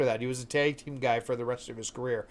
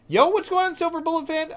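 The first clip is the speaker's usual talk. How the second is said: much higher, louder hype-man voice; hyped character greeting; 5 dB louder than usual. Acted. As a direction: shout a cheery greeting to the fan. Reading a game character's greeting.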